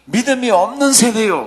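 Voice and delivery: loud, high-pitched rebuke; scolding. Retold or imitated. Jesus rebuking the faithless generation, loud scolding. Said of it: "야단을 치습니다".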